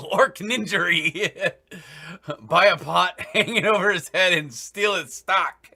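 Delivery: laughing through the words; giggling as he talks. Real, not acted. GM laughing while joking.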